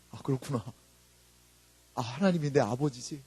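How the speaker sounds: usual speaking voice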